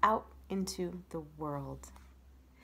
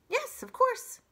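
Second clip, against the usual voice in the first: high, louder, perky reply; eager opossum; 6 dB louder than usual. Acted. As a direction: agree brightly in a small animal's voice. Opossum answers the courteous stranger.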